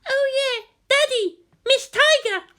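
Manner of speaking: put-on very high, bright child's call; a kid calling her dad; squeaky happy child shout; excited little girl calling. A narrator's reading.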